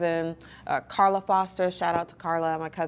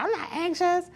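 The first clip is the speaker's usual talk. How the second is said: high-pitched defensive protest; hammed-up offended denial; mock indignant denial; 13 semitones higher than usual. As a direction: protest far too much, voice jumping up. Acting out denying being anxious.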